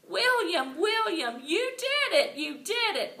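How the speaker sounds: high, loud happy cheer; joyful holler. A narrator's reading. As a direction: pile on him cheering. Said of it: "we hollered real joyful".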